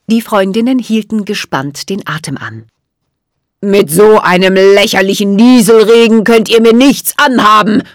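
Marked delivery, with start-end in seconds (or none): 3.6-7.8 hissed, scornful villain voice; loud, contemptuous sorcerer's sneer; Jack Frost. A narrator's reading. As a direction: hiss it with icy scorn. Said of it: "zischte Jack Frost".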